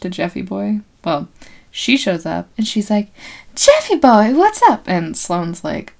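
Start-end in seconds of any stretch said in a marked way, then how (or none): 3.6-4.8 higher, louder bubbly hello; cheery greeting. Retold or imitated. Nurse calling out happily to her supposed fiance. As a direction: sing out the greeting.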